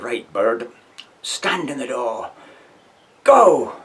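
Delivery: barked order building to a shouted go; a drill sergeant sending him out, go yelled high; sergeant's command. Retold or imitated. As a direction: sergeant ordering the first man out, then yelling go.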